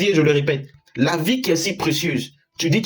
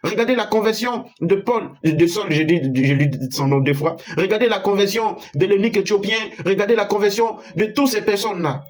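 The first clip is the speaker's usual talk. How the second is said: raised, impassioned preaching voice; fervent preaching; 6 semitones higher than usual. Acted. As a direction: preach it with rising fervour. Rising fervour in the sermon.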